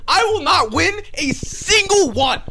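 high, slow, despairing whine; exasperated whine. Real, not acted. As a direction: wail it in despair. Exasperated loser rant.

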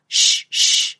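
two short hushing snake hisses; voiceless "shh" snake noise. Acted. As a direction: hiss "shh" twice like a snake. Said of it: "a spotty snake that hisses".

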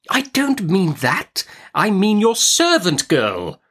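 high, slow, emphatic correction; impatient Miss Betsy. A narrator's reading. Aunt corrects mother's misunderstanding, impatient. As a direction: spell it out slowly in exasperation.

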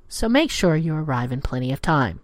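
usual speaking voice

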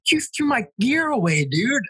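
high-pitched mock outrage at a buddy; retold freak-out. Buddy upset over his thrown-away gear, retold freak-out.

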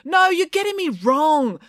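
slow, very high call over a crowd; high, drawn-out public protest. A narrator's reading. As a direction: project urgently over the hubbub. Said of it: "called out over the crowd".

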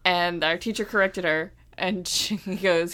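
ordinary talk

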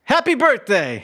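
sudden high-pitched gleeful shout; yelled birthday greeting. Pretend. Calls out his catchphrase on cue.

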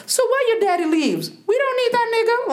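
very high-pitched imitation of mom brushing it off; dismissive mother. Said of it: "mama throughout the years kept telling me".

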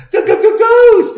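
loud, very high stuttered yell; mock terrified scream. Skeptic mocking people who panic at ghosts, mock terrified scream. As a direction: stammer it out as a hammed-up shriek.

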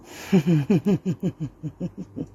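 rising villain laugh; evil laugh. Acted. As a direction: laugh like a gloating demoness. Villain laugh after taunt.

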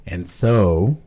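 deep, slow, deliberate narrator voice; low, measured and grandly unhurried; Morgan Freeman. Acted. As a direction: pronounce every syllable, with long weighty pauses. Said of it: "impersonating him now".

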